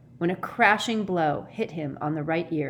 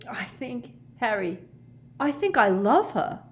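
strained and high, bursting out at the end; strangled voice; 6 semitones higher than usual. A narrator's reading. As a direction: choke out a declaration of love. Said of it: "in a strangled voice".

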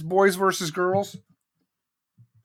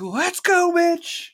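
much higher, louder, strained voice; high pitched mock-furious shout; battle cry; 12 semitones higher, 4 dB louder, much slower than usual. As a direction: yell the challenge like a charging kid. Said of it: "I was like".

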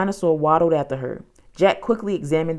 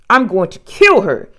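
loud, exasperated grumble, hardly muttered; higher, louder fed-up complaint; muttered exasperation; 4 semitones higher, 8 dB louder than usual. A narrator's reading. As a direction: grumble forcefully in frustration. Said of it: "Jack muttered".

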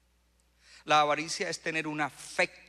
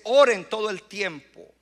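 loud, raised preaching command; emphatic proclamation; 6 semitones higher, 13 dB louder than usual. Acted. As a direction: proclaim it high and forcefully.

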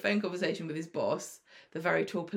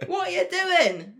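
much higher, louder, laughing rant; mock furious scolding; 9 semitones higher, 3 dB louder than usual. Boss berating Spider about the bomb, high and loud with laughter. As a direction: scold him like a fed-up boss. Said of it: "It's like, I'm sorry".

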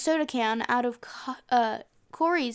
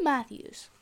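normal talking voice

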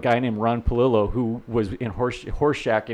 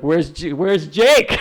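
louder, higher imitation of pestering people; mock calling out; 5 semitones higher, 6 dB louder, much slower than usual. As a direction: call out like everyone hunting for him. Imitating people asking everywhere he goes.

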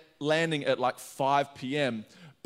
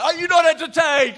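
high, full-voiced Gladiator shout; movie-quote shout; 13 semitones higher, 11 dB louder than usual. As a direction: shout it out to a whole arena. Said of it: "Do I shout this out".

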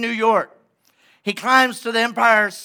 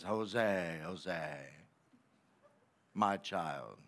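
very low, hushed, slow divine call; God calling softly from a cloud, deep and quiet; deep, soft heavenly voice; 14 semitones lower, 17 dB quieter, much slower than usual. Acted. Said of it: "hear the voice of God".

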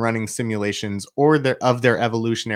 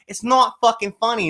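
grandma's angry Boston voice; 11 semitones higher than usual. Retold or imitated. Grandma scolding laughing kids in a Boston accent. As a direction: scold them, high and sharp, in a Boston accent. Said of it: "and she's like".

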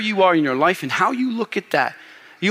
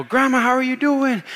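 cheery greeting; 8 semitones higher than usual. Retold or imitated. Greeting grandma as she walks into the hospital room, cheery. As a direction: greet her in a high, sing-out voice.

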